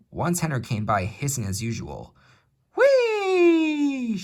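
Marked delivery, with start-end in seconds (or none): 2.8-4.2 very high, loud steam whistle cry; sudden loud wheesh. Acted. Percy startles Henry with a sudden loud wheesh. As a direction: let out a sudden piercing whee. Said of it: "said Percy suddenly".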